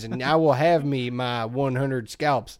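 slow, hammed-up Southern accent; Aldo Rains. Imitating the actor's film speech, slow mock Southern drawl. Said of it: "I came up with this accent".

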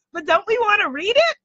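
ordinary talk, nothing marked

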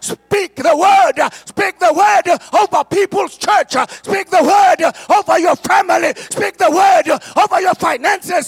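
building from loud to shouted high preaching; preaching at full force. Real, not acted. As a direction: whip the church into declaring it.

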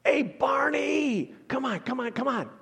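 an angel shouting to calm panicking shepherds; angel calming panicked men. Retold or imitated. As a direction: call the shepherds by name and settle them down. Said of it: "Did the angel say".

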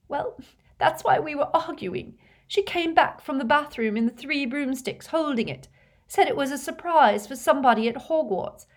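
put-on high, upset girl's voice; shaken, distressed. A narrator's reading. Leanne explaining the argument, shaken and tearful. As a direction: explain while badly upset. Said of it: "had started to shake uncontrollably".

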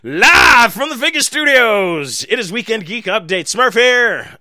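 loud, high show announcer. Acted. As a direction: boom it out like a radio host.

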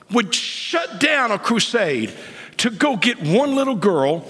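high, rising preacher's challenge; impassioned preaching. Real, not acted. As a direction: pitch it up and press the crowd.

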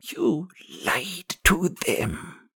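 put-on very slow, high, accusing old woman; gravelly, high, drawn-out reproach. A narrator's reading. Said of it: "The old woman's gravelly voice".

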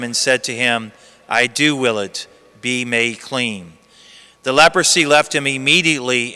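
measured liturgical reading voice; Gospel reading. Acted.

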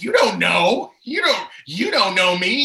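loud, high, indignant comeback; defensive retort. Retold or imitated. Hitting back at the pastor's wife, defensive. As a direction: push back hard, reenacting the argument.

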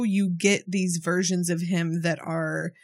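ordinary delivery

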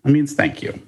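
ordinary talk, nothing marked

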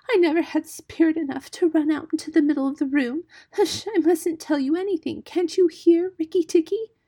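high, loud tearful muskrat voice; sobbing. A narrator's reading. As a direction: plead pitifully, close to tears. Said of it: "he sobbed".

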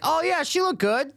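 high-pitched mock quote of a friend; mocking imitation. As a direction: parrot his shallow praise way up high. Mock-quoting the co-host's shallow comment.

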